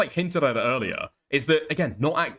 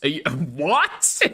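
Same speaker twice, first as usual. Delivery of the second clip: laughing disbelief; slower than usual. Real, not acted.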